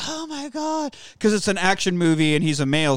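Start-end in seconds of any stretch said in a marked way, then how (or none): 0.1-0.9 high, soft swooning female voice; mock post-sex swoon; swooning lover. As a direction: gasp it softly, high and overcome. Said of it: "she's laying there like".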